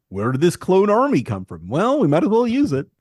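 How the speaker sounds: feigned wonder swinging into a breezy shrug; Palpatine. Retold or imitated. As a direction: play a villain faking surprise, high and loud. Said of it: "like Palpatine in, uh, episode two".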